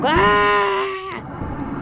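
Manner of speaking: high sudden yelp; startled scream. Real, not acted. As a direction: yelp in fright. Fleeing the killer.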